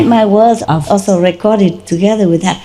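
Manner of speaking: ordinary talk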